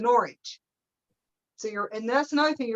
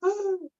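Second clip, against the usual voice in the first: mock sobbing gasp; 12 semitones higher than usual. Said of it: "sitting there crying".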